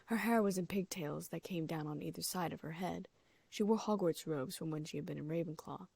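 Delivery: ordinary delivery, nothing marked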